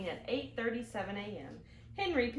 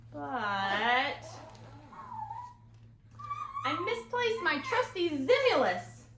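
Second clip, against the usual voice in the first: put-on excuse-making boy; 8 semitones higher, slower than usual. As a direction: sell the made-up word proudly. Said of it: "said Henry".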